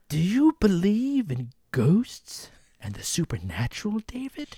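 eerie ghost-story whisper; spooky whisper. Pretend. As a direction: breathe it like a campfire tale. Asking the spooky ghost question.

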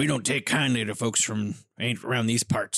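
put-on old-time backwoods voice; hammed-up unwelcoming local; suspicious country local. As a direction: warn off strangers like a wary townsman. Mock hostile small-town local.

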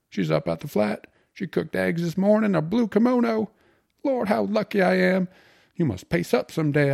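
high, loud, elated bragging; grinning, exultant slangy bridegroom; joyful triumph. A narrator's reading. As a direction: gush the good news like a winner. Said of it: "with a victor's smile and flushed with joy".

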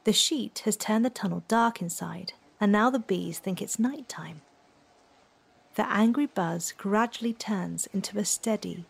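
ordinary delivery, nothing marked

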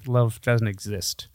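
usual speaking voice

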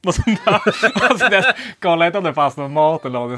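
laughing through the words. Real, not acted. Laughing while telling friends about the boss. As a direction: giggle it out high and loud.

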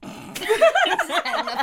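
laughing. Real, not acted. Laughing reaction.

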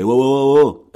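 loud calling out, high and strong; loud cop shout. Retold or imitated. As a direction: stop him with a sharp wow. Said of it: "un des flics qui lui fait". (flic qui crie fort pour arrêter)

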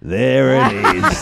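slow, drawn-out reply; imitating a reluctant patient, slowly; mock sulky patient. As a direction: draw out each word. Imitates Fiona producing pedometer from pillow.

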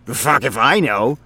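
a rambling, self-amused office guy; chatty coworker voice, in a different timbre from the narrator; Frink, chatty coworker. Acted. Frink voice again.

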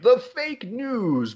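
high, drawn-out mock alarm; send-up of an overblown catchphrase; mock outcry. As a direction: stretch it out way up high, parodying a slogan. Mocking a slogan he disowns.

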